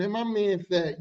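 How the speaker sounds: ordinary talk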